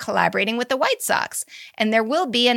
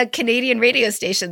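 ordinary delivery

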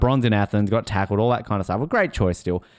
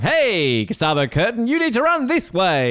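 rising high bossy call; playful high call of directions; mock sign pointing; 11 semitones higher than usual. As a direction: direct the runner like a shouting steward. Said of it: "like, going".

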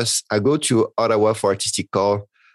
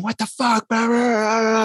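much higher, hammed-up furious rant; mock furious rant; 11 semitones higher than usual. Imitating the director raging in his office, mock fury. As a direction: play the boss raging behind the door. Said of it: "in the office saying".

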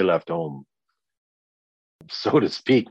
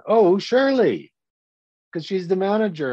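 mock obvious answer; 12 semitones higher, 5 dB louder than usual. Speaker sarcastically answering his own question, high sing-song. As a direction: act out the obvious answer as if it were a game show.

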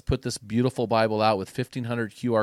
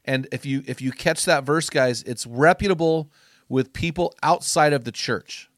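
louder, higher, rousing sermon voice; fired-up preaching; 3 semitones higher, 5 dB louder than usual. Acted. Podcast host rallying men to finish strong, fired-up preaching.

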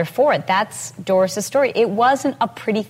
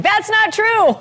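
much higher, louder, called out; 13 semitones higher, 4 dB louder, slower than usual. Shown as a demonstration. Fitness host calling out mid-video, loud and high. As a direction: blurt it out at full voice to the camera. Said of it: "And then I just say out loud".

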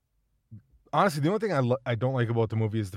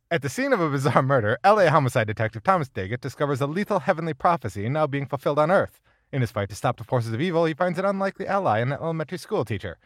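put-on loud, higher old-time newsreel announcer; 1930s voice; 4 semitones higher, 6 dB louder, faster than usual. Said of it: "It's gonna turn into weird nineteen thirties gangster".

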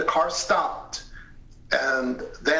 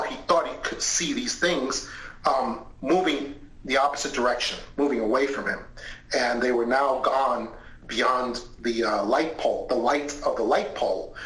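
usual speaking voice

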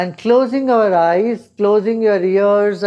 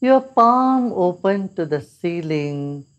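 guided meditation. Acted. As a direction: ease them gently into calm.

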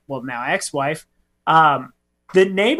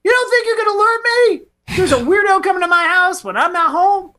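high, loud hammed-up indignation; mock outrage; 9 semitones higher, 3 dB louder than usual. Hammed-up outraged retort to the nosy neighbor. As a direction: fire it back in mock outrage, pitched way up.

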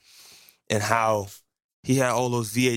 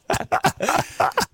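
high breathy laugh. Real, not acted. Laughs at own joke.

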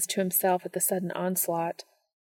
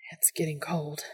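breathy whispered words; breathless; 3 semitones lower, 6 dB quieter than usual. Acted. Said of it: "Dean noted breathlessly".

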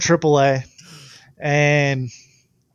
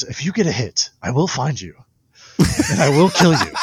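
quoting a movie line; 4 dB louder than usual. Retold or imitated. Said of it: "the whole quote of that movie".

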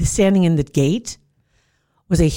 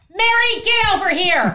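high, loud shouting of a name; mock yell; 4 dB louder than usual. Woman shouting for her runaway dog. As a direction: call the dog like a frantic owner. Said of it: "I hear this woman yelling".

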